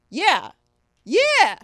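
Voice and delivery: loud, high, escalating yells of agreement; hyped-up lineman. Retold or imitated. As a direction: yell each one like a pumped-up player. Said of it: "some offensive lineman was convinced that".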